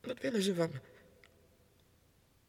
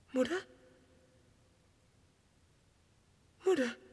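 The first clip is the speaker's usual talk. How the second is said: calling out; 4 semitones higher than usual. Acted. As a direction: call out into the distance. Calling for his mother. (roepend naar moeder, steeds luider)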